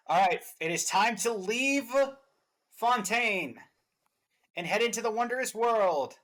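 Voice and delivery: loud, high-pitched showy announcement; grand announcer. Real, not acted. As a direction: announce the next destination with fanfare. Host announces next region grandly.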